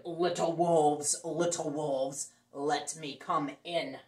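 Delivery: low, loud, slow pig voice; big bad pig. Big bad pig at the wolves' door, low, loud and slow. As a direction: loom over the door like a hungry pig. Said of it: "The pig knocked on the door and grunted".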